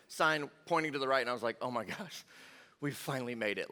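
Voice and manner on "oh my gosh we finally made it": hushed, lower relieved voice; acted relief. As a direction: let it out softly, as if finally safe. Retelling relief at seeing exit sign.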